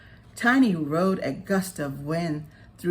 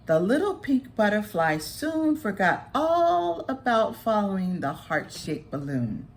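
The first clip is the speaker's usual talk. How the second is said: plain reading voice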